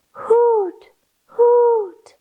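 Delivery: high hooting owl call. Acted. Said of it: "Owl said".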